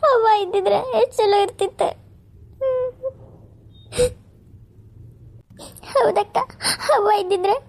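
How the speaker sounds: put-on high, teary young woman's voice fighting sobs; tearful younger sister. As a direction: say goodbye to her elder sister through tears. Younger sister crying, thanking.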